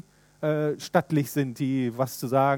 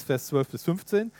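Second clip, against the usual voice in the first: ordinary talk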